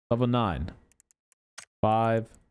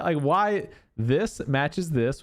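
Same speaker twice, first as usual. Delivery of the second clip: ordinary talk, nothing marked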